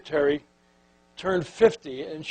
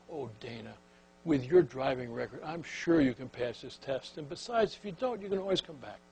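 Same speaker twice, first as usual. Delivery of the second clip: softer, gentle reassurance; soothing clerk; 3 semitones lower, 11 dB quieter than usual. Retold or imitated. Clerk comforting a panicked Dana, soft and reassuring. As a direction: pat her hand with your voice.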